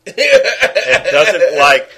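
breaks into laughter mid-phrase; laughing. Real, not acted. Host laughing at his co-host's joke, laughing through it.

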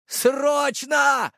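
very high, drawn-out shout; urgent yell. A narrator's reading. Same yell for the medic. (истошный срочный крик)

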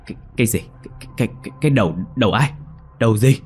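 stunned mother stammering what head; shocked stammer. Acted. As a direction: trip over the words in shock. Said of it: "trố mắt hốt hoảng".

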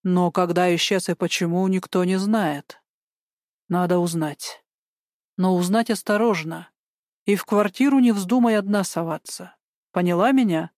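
ordinary delivery

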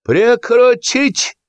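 very high, drawn-out yell; outraged director calling a halt; furious command. A narrator's reading. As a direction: cry the order out, stretching it. Director furiously orders him to stop.

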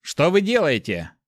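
indignant shout. A narrator's reading. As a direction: call out sharply to stop them. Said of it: "выкрикнул парень".

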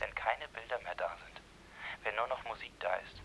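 hurried hush. Acted. Hushed hurried interjection.

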